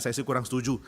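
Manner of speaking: usual speaking voice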